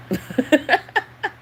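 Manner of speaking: high, loud burst of laughter; high-pitched cackling laugh; real laugh. Real, not acted. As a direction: break out laughing. Laughs at own claim.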